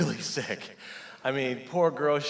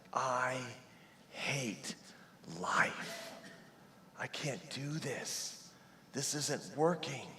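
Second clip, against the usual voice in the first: whispered, much softer, slow; whispered despair at rock bottom; 9 dB quieter, much slower than usual. Retold or imitated. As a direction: breathe out hopeless words. Despair quoted to God.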